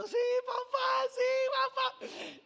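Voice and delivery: put-on very high, loud, slow child's cry; scared little girl. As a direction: shout it like a terrified kid at the top. Scared daughter on the ride. (imitando a la niña asustada, gritando muy agudo y lento)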